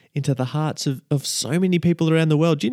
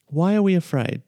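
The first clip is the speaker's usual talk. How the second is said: ordinary delivery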